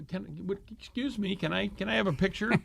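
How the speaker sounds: small shy asking voice, a bit fast; timid fan voice. Retold or imitated. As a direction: ask like a nervous fan.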